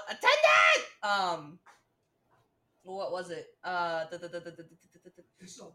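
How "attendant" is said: high, loud imitated yell; yelled summons. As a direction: yell out for the attendant. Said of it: "'Cause he yells out".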